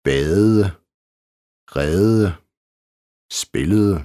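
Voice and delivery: badede, redede, spillede with drawn-out soft D endings. Shown as a demonstration. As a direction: melt the -ede of "badede", "redede", "spillede" into th-like sounds. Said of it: "I verbalendelsen". (blødt D i badede, redede og spillede)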